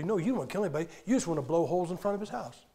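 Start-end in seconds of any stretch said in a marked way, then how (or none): none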